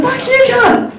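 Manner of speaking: very high mock child yell; high acted toddler outburst; screaming toddler. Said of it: "standing there screaming at his mum".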